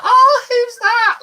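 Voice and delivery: curious stranger. Retold or imitated. Strangers asking about the teddy.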